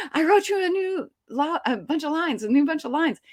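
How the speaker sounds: high, eager announcement; Quentin, excited. Retold or imitated. Director running over to the actress with new pages, excited. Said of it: "Quentin is all excited".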